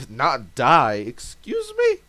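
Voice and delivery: disbelieving squeal; mock outraged disbelief; incredulous. Incredulous player reply.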